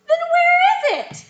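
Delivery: high, loud and slow puzzled question; wide-eyed disbelief; surprised question. Shown as a demonstration. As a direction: ask it like you truly can't believe it.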